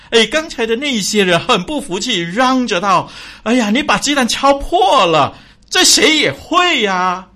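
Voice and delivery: loud high indignant shouting; clamoring. Acted. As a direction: protest loudly and high.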